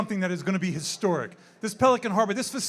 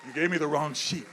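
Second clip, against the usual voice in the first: normal talking voice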